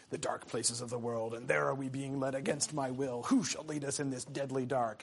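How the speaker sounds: low, soft grumble; under his breath. Acted. Boromir complaining as they enter Moria, under his breath. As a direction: grumble it softly, half to yourself. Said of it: "things that he says under his breath".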